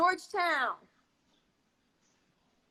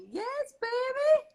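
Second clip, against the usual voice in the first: high, slow, delighted; excited; 11 semitones higher, 3 dB louder, slower than usual. Real, not acted. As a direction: gush over the comment. Excited reading of comments.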